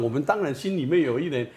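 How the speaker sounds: ordinary talk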